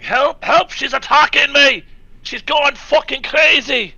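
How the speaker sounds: high, loud, frantic cries for help; yelling for help. Acted. Player calling guards for help, panicked yelling. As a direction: scream for the guards like your life depends on it. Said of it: "I start yelling".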